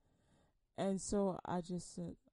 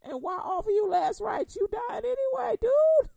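high, loud jeering taunt; mocking taunt yelled up high; mocking shout; 12 semitones higher, 10 dB louder than usual. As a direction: shout it like the devil sneering. Mock devil taunting, shouting.

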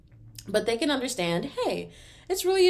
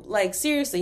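ordinary delivery

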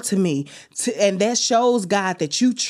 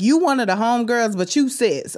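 ordinary talk, nothing marked

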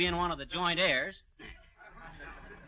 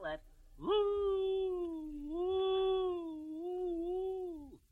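high hooting train whistle; 12 semitones higher, 7 dB quieter, much slower than usual. Retold or imitated. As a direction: imitate a lonesome train whistle. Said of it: "kind of a lonesome sound".

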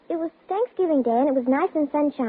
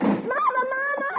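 high, breathless frightened calls with a sob; a terrified girl bursting in, crying for her mother; 9 semitones higher, slower than usual. Acted. Girl running home to her mother, crying out in fright.

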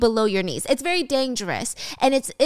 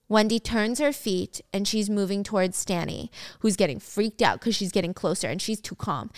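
usual speaking voice

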